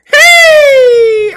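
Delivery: startled yell at the screen; sudden shout. Real, not acted. Gamer yelling at his opponent. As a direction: shout out loud.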